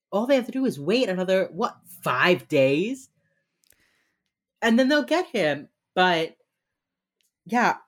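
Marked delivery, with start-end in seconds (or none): none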